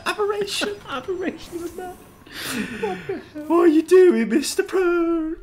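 very high, squawking mock indignation; mock outraged gent. Gamer ribbing his mate with silly insults, very high mock outrage. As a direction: pitch it absurdly high as a scandalised old-timer.

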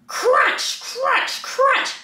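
high crisp crunch words; high loud crunches; crunching sound. Acted. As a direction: make it sound like biting. Sound words read expressively.

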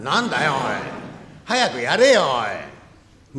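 loud, pushy hurry-up; impatient shout. Acted. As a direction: bark at him to get on with it. 話をせかす. (早くやれと大声でせかす)

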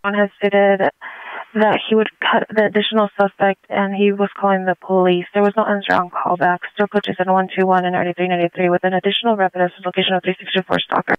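dispatcher heard through a radio; police radio. Real, not acted. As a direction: read the call as if over a police radio. Dispatch over a radio channel.

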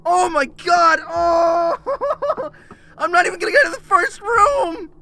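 high, loud, half-crying; tearful, shouted despair; distressed wail. Real, not acted. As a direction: moan loudly in hopeless panic. Overwhelmed by first room.

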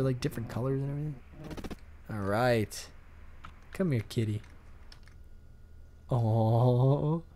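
ordinary talk

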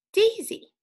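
high, loud happy "Daisy"; thrilled teacher reveal; excited reveal. Shown as a demonstration. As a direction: announce "Daisy" as a delightful find.

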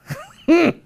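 short, loud, very high squeal. Acted. Pastor venting under the surface, short high squeal. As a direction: squeal as pressure escapes. Vents after the ooh-wee.

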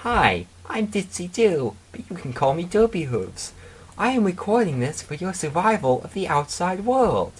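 very high-pitched cartoon pony voice; Ditzy Doo. A narrator's reading. Pony recording a survival message, very high clear voice. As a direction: put on a high little pony voice, clear as day. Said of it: "a voice as clear as day is heard".

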